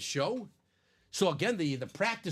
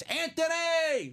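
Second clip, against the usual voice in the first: sudden excited call; excited announcement; 13 semitones higher, much slower than usual. Real, not acted. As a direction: announce the winner with a burst.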